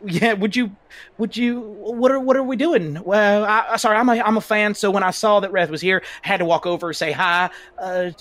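put-on southern accent. [name] greeting Ref as a fan, southern accent. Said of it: "I just really like your southern accent".

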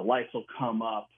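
ordinary talk

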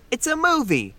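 high-pitched exasperated protest. Acted. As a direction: protest in disbelief, pitch jumping up. Exasperated objection to boast.

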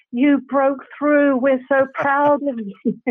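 high, drawn-out congratulations, slowing on the first words; proud congratulations. Retold or imitated. Extras hug her after she booked the job, proud congratulations. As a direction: imitate friends gushing with pride.

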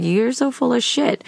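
dad's retort. Retold or imitated. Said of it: "he was like".

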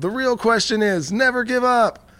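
a kid's high yell off a boat, retold; mock yell. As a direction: shout it like jumping into the water. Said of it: "and he yelled".

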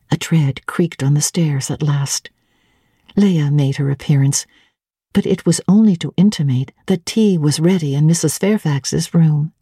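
usual speaking voice

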